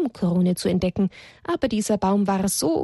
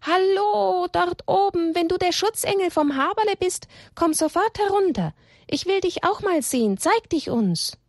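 high-pitched call up to someone; bold, sustained high holler in a storyteller's measure; calling out loudly; 12 semitones higher than usual. A narrator's reading. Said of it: "rief ganz laut".

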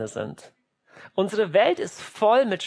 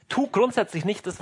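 ordinary talk, nothing marked

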